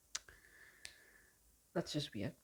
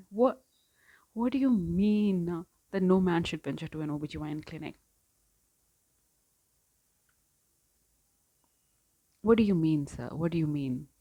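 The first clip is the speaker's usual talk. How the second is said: loud, incredulous challenge; exasperated disbelief; 8 dB louder than usual. Real, not acted. Reader challenging the character over his excuse, loud exasperation. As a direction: demand an explanation from him, loudly. Outraged at the book's line.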